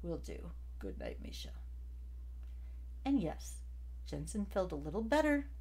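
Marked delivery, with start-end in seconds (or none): none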